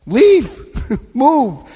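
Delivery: high, loud one-word order; forceful command. Acted. Telling the audience to leave, loud high command. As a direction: bark it like a final verdict. Urging listeners to leave bad places.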